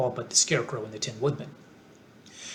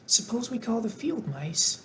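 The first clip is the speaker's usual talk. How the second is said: Dorothy voice; 8 semitones higher than usual. A narrator's reading. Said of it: "she suggested".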